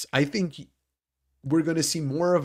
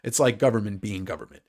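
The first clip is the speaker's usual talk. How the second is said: ordinary talk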